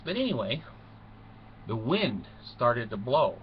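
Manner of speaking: normal talking voice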